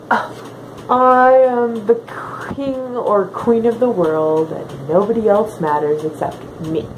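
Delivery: ordinary talk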